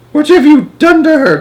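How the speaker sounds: very high, loud anguished cry; distraught cry. A narrator's reading. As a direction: cry out in horror. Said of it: "cried Missus Bucket".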